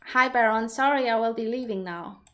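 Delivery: normal talking voice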